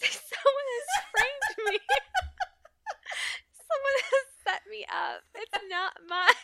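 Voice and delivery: high, laughing mock outrage; laughing protest. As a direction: protest while cracking up. Acted denial, losing it.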